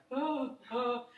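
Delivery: high, loud, stricken "oh, oh"; taken aback. Retold or imitated. Recalling her shock at the pizza guy, taken aback. Retold shock at the phone remark.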